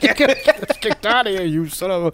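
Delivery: teasing mimicry of his wife; mock high whine. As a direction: parrot her back in a silly high voice. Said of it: "I was like".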